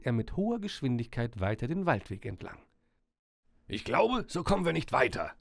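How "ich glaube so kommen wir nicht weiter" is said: raised, higher-pitched call; mild character shout. A narrator's reading. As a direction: call it out to your companion. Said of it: "rief Herr Köhler".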